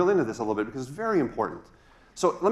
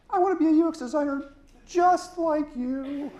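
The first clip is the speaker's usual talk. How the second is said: Timmy the pencil voice. Pretend. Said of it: "Timmy's my little pencil friend".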